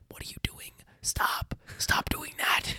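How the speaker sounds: urgent mock whisper; whispered plea to cut it out; panicked hushing. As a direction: whisper a frantic scolding. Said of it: "And I'm like".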